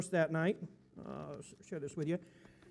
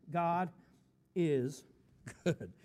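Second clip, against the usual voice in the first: ordinary talk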